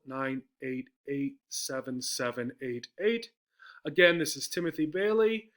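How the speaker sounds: usual speaking voice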